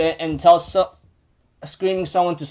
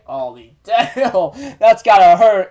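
drawn-out, laughing reaction; amused wince; 2 semitones higher, 5 dB louder, slower than usual. Real, not acted. Narrator reacts to Elaine's harsh put-down, laughing. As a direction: laugh through a slow exclamation.

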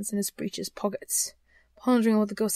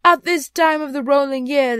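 high, loud spectral lament; suffering ghost; 6 semitones higher, 10 dB louder than usual. Acted. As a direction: declaim like a grieving spectre. Voices the ghost in the story.

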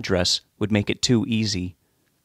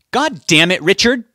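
exasperated screech, high and loud; 10 semitones higher, 7 dB louder, slower than usual. A narrator's reading. Betty rounds on her husband Richard, screeching. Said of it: "Betty screeched".

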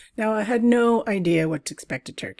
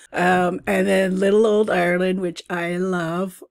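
ordinary talk, nothing marked

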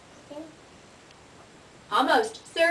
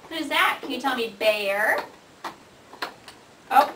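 ordinary delivery

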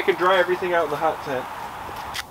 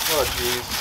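normal talking voice

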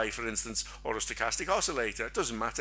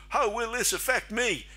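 high, daft questioning voice; 10 semitones higher than usual. Pretend. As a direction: ask it like a clueless fool, pitched way up. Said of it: "That's why we do it with a daft voice".

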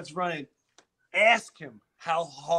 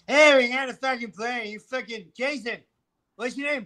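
mock Norm; 6 semitones higher, 4 dB louder than usual. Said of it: "Norm is like".

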